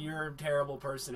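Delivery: soft fast dismissive line; understated cold insult; cold mutter. Retold or imitated. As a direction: mutter it flatly. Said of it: "he says something along those lines".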